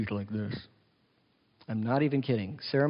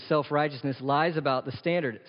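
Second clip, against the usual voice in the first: normal talking voice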